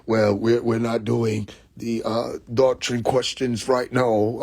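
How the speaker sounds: slow, quiet, lightly put-on official voice; imitating the official. Mocking the official who dodged his question, quiet and slow. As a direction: mimic the stiff official, slowed and hushed. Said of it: "the accent and everything faded away".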